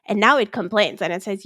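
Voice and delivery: ordinary talk, nothing marked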